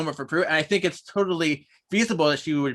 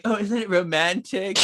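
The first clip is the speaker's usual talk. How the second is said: dreamy Phoebe impression; 5 semitones higher than usual. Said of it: "Phoebe is like".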